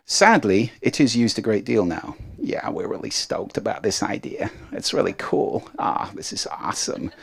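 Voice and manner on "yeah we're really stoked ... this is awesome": soft creaky vocal-fry impression of Americans; mock-enthused words in a low-energy croak. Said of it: "if you tend to speak like this".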